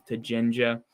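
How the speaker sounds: ordinary talk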